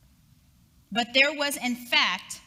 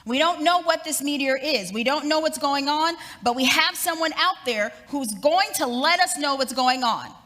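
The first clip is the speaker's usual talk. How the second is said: higher and louder urgent radio announcement; urgent announcer; 4 semitones higher, 4 dB louder than usual. Retold or imitated. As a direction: announce breaking news with rising alarm. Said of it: "And they said".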